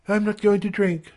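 high-pitched female character; high voice, simple refusal; woman declining. A narrator's reading. Janet's voice in the narration.

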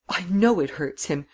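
high, distressed protest climbing upward; acted upset, pitch rising high; anguished plea. A narrator's reading. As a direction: plead as if unable to bear it. Miss Cummins distressed over the horse.

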